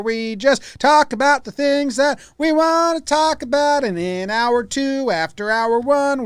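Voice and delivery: slow, lightly sung-along announcer read; drawn-out show opener; radio host intro. Acted. Hosts the show intro slowly.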